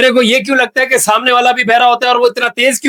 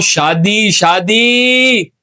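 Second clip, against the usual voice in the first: insistent slow repeat; much slower than usual. Acted. Interviewer corrects deaf guest, शादी repeated long and slow. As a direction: repeat शादी, drawing the second one right out.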